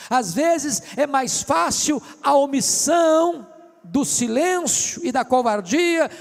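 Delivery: emphatic preacher's build, slow and punched; forceful preaching. Real, not acted. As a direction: hammer each phrase home to the congregation.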